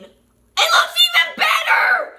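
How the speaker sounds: high shrieking laughing joy; excited shriek. Real, not acted. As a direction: shriek in delight. Shrieking at the result.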